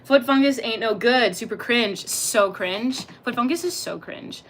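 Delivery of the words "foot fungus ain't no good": usual speaking voice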